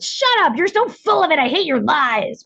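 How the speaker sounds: lightly voiced angry kid; inner child. Inner child snapping back at the affirmations, mock bratty child. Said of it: "my inner child voice would".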